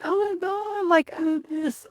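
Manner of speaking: high, breaking, tearful; breaking down when the friend shows up; crying. Retold or imitated. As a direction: sob it out high and choked. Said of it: "I started crying 'cause she was there".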